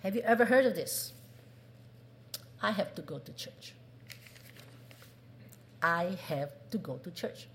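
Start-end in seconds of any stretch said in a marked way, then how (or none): 2.6-7.5 reluctant duty. Shown as a demonstration. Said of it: "What do you hear?".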